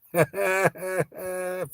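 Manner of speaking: high gleeful cackle; high triumphant laugh. Real, not acted. Laughing after blocking a troll.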